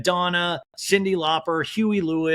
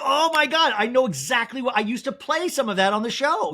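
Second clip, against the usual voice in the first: high-pitched burst of delighted recognition; excited recognition; 7 semitones higher than usual. Real, not acted. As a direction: gush as the memory hits you, pitch jumping up. Thrilled recognition of the mashup.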